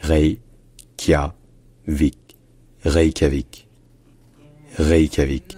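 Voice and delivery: "Reykjavik" en trois syllabes, réï-kya-vik; adaptation française. Shown as a demonstration. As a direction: franciser "Reykjavik", r non roulé. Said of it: "en français, on adapte ça". (« Reykjavik » francisé en trois syllabes)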